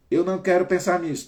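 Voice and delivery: "Eu não quero pensar nisso" high, loud and fast, punching "quero"; anxious loud insisting on "não"; emphatic refusal. Shown as a demonstration. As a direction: blurt out the negative thought with force. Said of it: "Tira o não".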